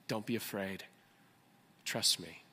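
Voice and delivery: low, soft, comforting words; gentle reassurance. Acted. God reminding someone ready to give up, gently reassuring. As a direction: reassure them quietly, unhurried.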